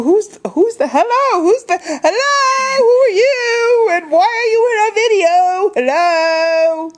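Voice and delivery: high, drawn-out fake indignation; mock outrage. Host confronting a girl in their video, mock outrage.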